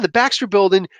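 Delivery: ordinary delivery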